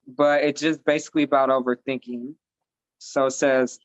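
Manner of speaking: ordinary talk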